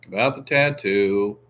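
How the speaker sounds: ordinary delivery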